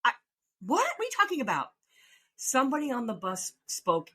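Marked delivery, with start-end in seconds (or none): 0.0-1.7 shocked disbelief. Retold or imitated. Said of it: "I said".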